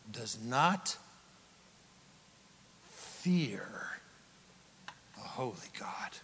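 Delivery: low, breathy whisper; preacher hushing the church about fearing God; hushed warning. Real, not acted. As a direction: whisper it with awe.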